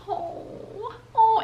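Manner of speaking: high, soft pitying coo; sympathetic oh. Retold or imitated. Tablemates pitying the crying newcomer, high soft coo. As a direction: coo at her like a hurt child. Everyone staring at her.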